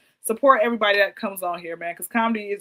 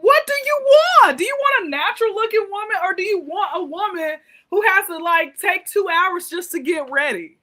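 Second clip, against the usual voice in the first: loud, high-pitched mock confrontation; exasperated demand; 7 semitones higher, 7 dB louder than usual. Woman challenging men who date Barbie dolls, exasperated. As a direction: launch high and loud, then ease slightly while still challenging.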